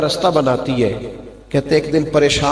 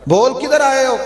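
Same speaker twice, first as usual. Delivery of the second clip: higher, louder demanding uncle; harsh uncle; 11 semitones higher, 3 dB louder than usual. Acted. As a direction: bark the question like a stern uncle. Hostile uncle confronts nephew.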